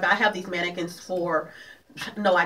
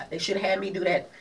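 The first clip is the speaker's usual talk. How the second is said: usual speaking voice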